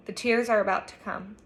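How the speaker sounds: usual speaking voice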